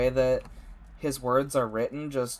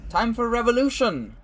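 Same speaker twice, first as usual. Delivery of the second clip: put-on loud, high call to revolution with a light accent; rallying cry; 8 semitones higher, 6 dB louder than usual. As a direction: rouse the crowd, full voice. Rebel announces the revolution.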